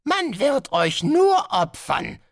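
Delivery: high, slow and serene; calm. Acted. Guest master calmly announcing the sacrifice, slow. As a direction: say the horror casually. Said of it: "fügte Gahatra gelassen hinzu".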